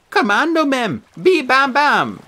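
put-on high, slow babyish wheedling; toddler-speak pleading. A narrator's reading. Beckett wheedles his brother in baby talk, high and slow. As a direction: coo it like a toddler twin begging. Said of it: "speaking in foul argot".

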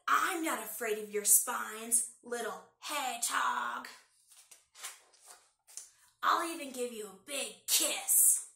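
slow, loud, sly drawl; drawling fox. Acted. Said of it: "drawled Fox".

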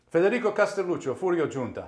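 a hint of a thick Italian accent, announcing his name; Italian accent, name and role at a read-through. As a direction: introduce yourself in a lightly put-on Naples Italian accent. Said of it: "with the accent".

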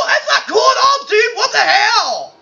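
high-pitched, outraged yelling; indignant protest. Acted. Slapped character objecting to the slapper, indignant high shout.

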